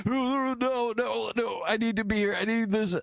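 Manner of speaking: high, desperate denial; hammed-up high desperate employee; desperate pleading. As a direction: beg to stay, high and anxious.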